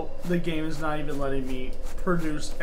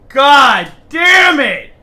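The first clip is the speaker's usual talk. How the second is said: higher, much louder slow shout; furious shout; 8 semitones higher, 12 dB louder, much slower than usual. Real, not acted. Streamer finding blood shouts in shock. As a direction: bellow it out in shock.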